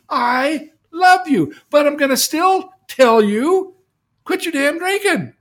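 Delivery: God begging his son to quit, close to tears; loving but forceful God. Acted. God pleading with son Craig, loud tearful love. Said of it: "No, he says".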